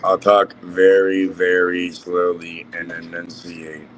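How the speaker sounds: slow, every word spelled out; slow and clear. Shown as a demonstration. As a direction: take it slowly, enunciating each word. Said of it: "You know, slow and succinct".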